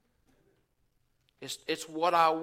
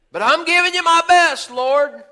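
higher, louder mock protest; indignant protest; 10 semitones higher, 9 dB louder than usual. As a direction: insist you gave your best, hammed up.

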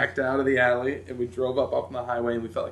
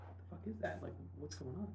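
alarmed hush; 5 semitones higher, 18 dB quieter than usual. Retold or imitated. Scared by truck behind them.

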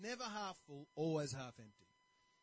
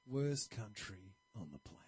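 negative barber; 8 semitones lower than usual. Retold or imitated. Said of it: "really negative sort of outlook".

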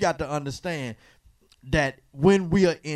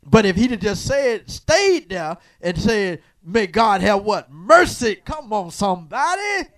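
louder and higher, rising preacher's call; preaching at full force; 5 semitones higher, 7 dB louder than usual. Acted. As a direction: drive it home to the congregation.

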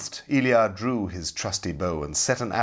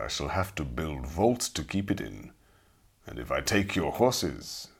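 calm, deadly hero; 5 semitones lower than usual. Acted. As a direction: weigh it, then doom them. Ilya calmly refuses, deadly.

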